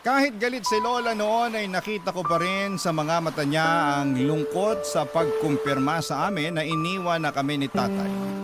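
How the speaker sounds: ordinary delivery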